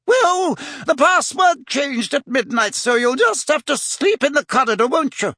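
high, put-on lady's voice, scolding; irritated fat lady. Fat lady refusing Harry entry, huffy. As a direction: tell him off in high-pitched indignation.

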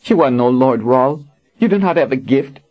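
high, sneering warrior. Acted. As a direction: scorn him openly. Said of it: "Kara sneered at him".

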